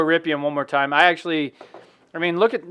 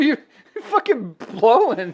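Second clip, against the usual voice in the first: stifled giggles; 6 semitones higher than usual. Real, not acted. Both fighting laughing fit.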